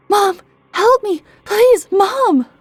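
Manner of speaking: high, loud terrified scream; screaming for mom. A narrator's reading. Boy grabbed from behind on porch, screams loud and high for mom. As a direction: scream for help at the top of your lungs. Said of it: "I screamed as loud as I could".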